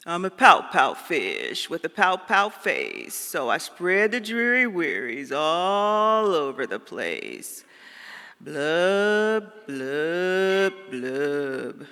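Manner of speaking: slow, droopy pout-fish voice; glum fish refrain. Acted. Reading the fish's sad refrain to listeners, slow and droopy. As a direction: drag out the gloomy refrain like a sulky fish.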